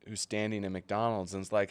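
ordinary talk

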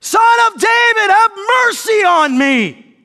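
full-force high, drawn-out cry. Acted. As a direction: shout it even harder, stretching every word. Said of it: "he only shouted louder".

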